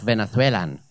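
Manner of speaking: affected Spanish lisp on Venethwelan; Spanish accent. Retold or imitated. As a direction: put on a Castilian accent for this word. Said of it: "That's how he'd say it".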